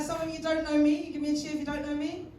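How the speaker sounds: ordinary talk, nothing marked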